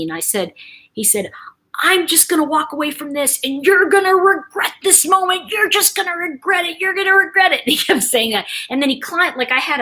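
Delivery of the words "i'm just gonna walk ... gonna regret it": high-pitched mock indignant rant; mad father. Daughter mimicking her dad storming off, indignant. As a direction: imitate an old man's huffy threat. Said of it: "so mad at me".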